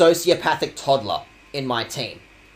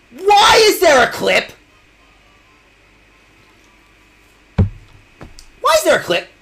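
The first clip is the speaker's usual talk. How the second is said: much higher and louder, slow and stretched; mock outrage; 12 semitones higher, 6 dB louder, slower than usual. Real, not acted. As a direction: wail it out as a hammed-up betrayal. Dramatic outrage at clip.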